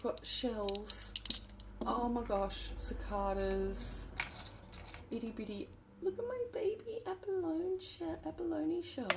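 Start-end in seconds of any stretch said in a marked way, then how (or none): 5.1-9.1 cooing whisper. Real, not acted. Cooing over her tiny abalone shell to viewers. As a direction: coo at it like a tiny pet.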